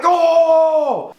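a high, loud, mocking "Oh!"; imitated crowd shout. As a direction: holler like the crowd at a rap battle. Crowd reacts to his comeback.

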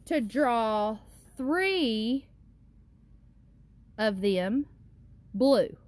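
slow, drawn-out worksheet reading; slow reading aloud. Acted. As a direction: read each word slowly for little kids.

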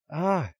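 short high, loud yelp; mock startled scream; acted cry of alarm. Villager running from Titans, acted cry of alarm.

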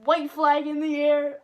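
loud, slow race call; excited call. Real, not acted. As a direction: announce it like the finish. Calling the race finish.

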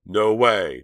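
loud, high scream of refusal; frantic brother screaming no. A narrator's reading. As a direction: scream it, he's losing control. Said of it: "Chuck screamed".

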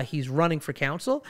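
ordinary talk

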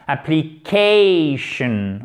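slow, stressed applicATION; stress before the last syllable. Shown as a demonstration. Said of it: "L'accent tonique est juste avant cette dernière syllabe". (accent tonique appuyé sur Application, lentement)

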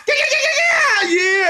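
high squealing yeah run; high excited yeahs. Real, not acted. Fan cheering the win in a high voice. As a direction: let the joy burst out high.

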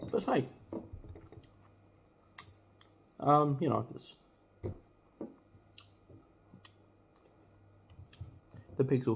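eating between words; speaking while chewing. Real, not acted. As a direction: mouth partly full. Seen 0: Bitten sandwich held throughout; lips pressed as if chewing mid-speech.

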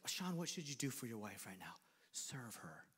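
very soft, low whisper; whispered prompting. Acted. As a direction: whisper the obvious answer. Mimics prompting with obvious answer.

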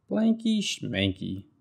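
high, slow, teasing brush-off; mock the blanket in a sing-song; cheeky little bird waving it away; dismissive Bird. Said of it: "said Bird".

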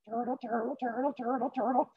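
small, high, soft chant; quiet high-pitched patter, evenly repeated; turtle trudging along. Acted. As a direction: chug along softly as a tiny cartoon turtle. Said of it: "still just turtling along".

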